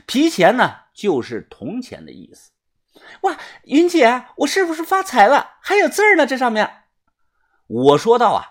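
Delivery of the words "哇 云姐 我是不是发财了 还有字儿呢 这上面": put-on high, excited girl's voice; delighted, pitched-up exclaiming; thrilled girl. A narrator's reading. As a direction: squeal to a friend about a lucky find. Girl thrilled at finding a coin.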